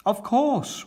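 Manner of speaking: high, bright offhand agreement; cheery Grandad Dog. Acted. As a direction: toss it off gladly.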